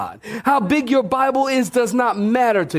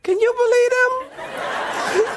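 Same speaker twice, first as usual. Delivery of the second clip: mock congregation gossip; 12 semitones higher, much slower than usual. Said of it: "looking at us going".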